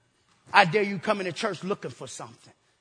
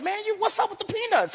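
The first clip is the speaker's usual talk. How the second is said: mock greeting; 10 semitones higher than usual. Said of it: "I said".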